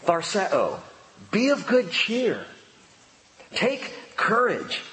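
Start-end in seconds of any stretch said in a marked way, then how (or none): 1.3-4.8 higher, slow, tender encouragement; gentle higher reassuring voice; voice of Jesus, encouraging. Shown as a demonstration. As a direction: say it as comfort from above. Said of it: "Just to hear him say".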